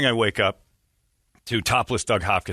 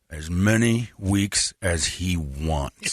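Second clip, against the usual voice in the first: very slow, cool deadpan; slow deadpan line; 4 semitones lower, much slower than usual. Retold or imitated. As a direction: let each word land slowly and calmly. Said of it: "Then I'm on".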